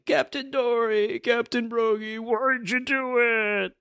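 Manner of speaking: high, drawn-out theatrical wail; mock crying. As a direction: wail slowly like a grieving crew. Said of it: "you hear everybody crying".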